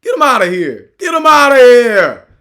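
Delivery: loud, high mocking dismissal of an outsider; comic loud get-him-out-of-here bit; barbershop clowning. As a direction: run him out like the guys at the barbershop. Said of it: "They'd be like".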